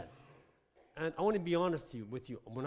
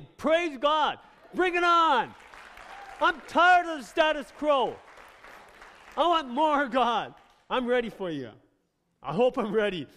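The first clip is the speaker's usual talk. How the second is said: high, loud exultant cries, easing slightly; fervent shouting; 11 semitones higher, 13 dB louder than usual. Real, not acted. Preacher crying out to God for more, fervent shouting.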